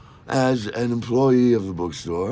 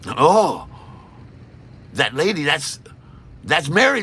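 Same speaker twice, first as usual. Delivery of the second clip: normal talking voice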